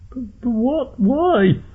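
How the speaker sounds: very high-pitched panicked stutter; frantic, squeaky disbelief; horrified stammer. Acted. Captive hears his ear will go.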